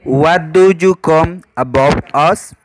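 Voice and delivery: ordinary delivery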